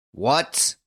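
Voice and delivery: high, rising disbelief; impatient cry. A narrator's reading. Judge reacting to the guard's doubt, impatient cry. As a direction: pounce on it, incredulous. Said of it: "cried the judge impatiently".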